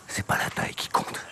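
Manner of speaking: soft breathy whisper. Acted. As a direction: whisper it like a secret. Same line repeated whispered.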